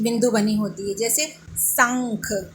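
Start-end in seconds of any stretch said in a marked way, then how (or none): 1.6-2.5 शंख drawn out slowly with a nasal hum before the ख; slow शंख, sounded through the nose; nasal anusvara. Shown as a demonstration. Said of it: "वो नाक से किया जाता है।".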